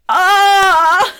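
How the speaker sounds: high, loud imitated crowd "aah"; loud high-pitched mock uproar; crowd's shocked cheer. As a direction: mimic a whole chamber erupting in one long cry. Imitates everyone reacting to the speech.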